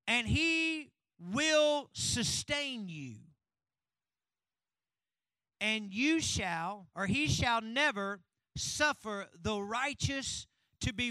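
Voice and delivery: very slow, word-by-word proclamation; weighty verse reading. Shown as a demonstration. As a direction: stretch out each phrase with force. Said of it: "you haven't heard it like this".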